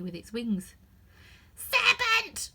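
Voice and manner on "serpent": much higher, louder scream. Acted. Pigeon screaming at Alice, much higher and louder. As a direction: shriek the accusation. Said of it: "screamed the pigeon".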